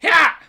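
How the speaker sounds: sharp high yell "Hah!"; Link battle cry. Pretend. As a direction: shout a short heroic grunt. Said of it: "can only grunt and scream".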